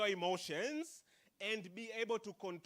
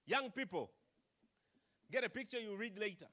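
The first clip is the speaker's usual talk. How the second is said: usual speaking voice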